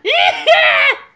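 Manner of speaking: high, loud cackle in a put-on voice; mock maniacal cackle. Comedian as a crazed designer after his threat, mock cackle. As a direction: cackle like a hammed-up madman. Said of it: "with that voice coming out".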